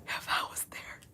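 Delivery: breathy whispered If I was there. Shown as a demonstration. Repeating her own line to chat, whispered. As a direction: drop it to a bare whisper.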